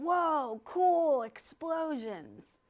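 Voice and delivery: put-on a cheeky kid wowed by the sight; excited boy. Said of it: "I remember him saying".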